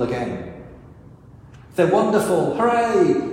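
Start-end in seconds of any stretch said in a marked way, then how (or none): 1.7-3.3 cheering crowd, higher and louder; mock cheering. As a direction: cheer like a fickle crowd, hammed-up.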